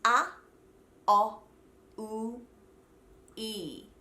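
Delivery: "A, O, U, E" each alone with pauses; four separate vowels. Shown as a demonstration. Coach recaps the long O, four spaced vowel sounds. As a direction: spell out the long O glide sound by sound, "A, O, U, E". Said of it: "has four sounds in it".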